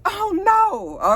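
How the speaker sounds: high-pitched, dismayed exclamation; mock alarm. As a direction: imitate someone reacting to terrible news. Acts out Mississippi's alarm at news.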